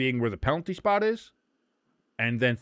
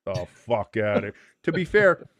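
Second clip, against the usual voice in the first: usual speaking voice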